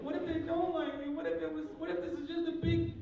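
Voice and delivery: high-pitched, loud fretting; hammed-up worry in a high voice; mock worried. Acting out a worried character's reply.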